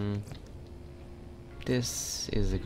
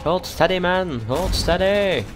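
urgent urging; 8 semitones higher, 7 dB louder, slower than usual. Real, not acted. Urging teammate during fight.

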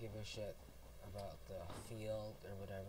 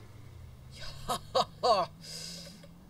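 loud, high "ha-ha" laugh; gleeful cackle; 6 semitones higher, 10 dB louder than usual. Pretend. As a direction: cackle at the sneaky plan. Springs surprise plan in game.

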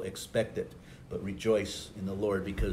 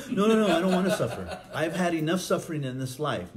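higher, louder, rapid refusal with laughter; mock panicked protest; 7 semitones higher, 6 dB louder than usual. Believer protesting when Jesus says they will suffer, mock panic. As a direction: play a hammed-up, high-pitched refusal.

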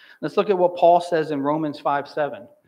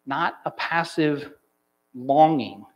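usual speaking voice

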